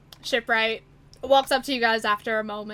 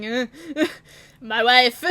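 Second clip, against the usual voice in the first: a shrug in the voice, laughing through it; laughing eye-roll about his wife; husband laughing, can't manage her; laughing exasperated husband; 7 dB louder than usual. Retold or imitated. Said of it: "It's like".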